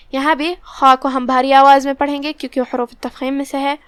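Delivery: ordinary talk, nothing marked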